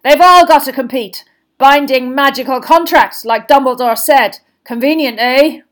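forceful, pointed argument; gruff Moody figure, challenging. Acted. Moody telling Karkaroff all must compete, challengingly.

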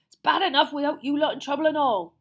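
loud, rough half-giant ordering them away; Hagrid's gruff voice. A narrator's reading. Hagrid sending the children back to the castle, loud and gruff. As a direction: boom it out like a big upset gamekeeper.

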